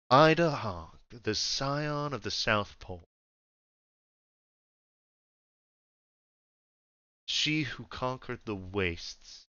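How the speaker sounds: lightly voiced ruler, trailing softly; understated regal menace; a measured Emperor sizing up a subject; imposing Emperor. Game master plays the Emperor.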